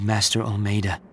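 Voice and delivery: usual speaking voice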